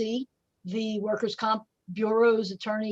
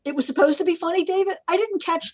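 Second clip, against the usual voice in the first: mock surprise; 7 semitones higher, 3 dB louder, faster than usual. Real, not acted. Teasing reply to failed joke.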